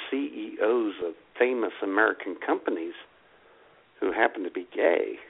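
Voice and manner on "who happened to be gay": ordinary delivery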